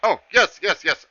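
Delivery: high, loud eager yeses; eager satyr. Acted. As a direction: grab at the offer greedily. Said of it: "satyr eagerly accepting candy".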